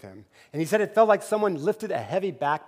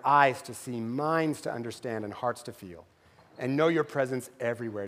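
closing prayer; 4 semitones lower than usual. Acted.

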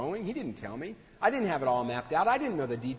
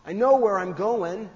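put-on exasperated teen; 6 semitones higher, 4 dB louder, slower than usual. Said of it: "she just looked at him and said".

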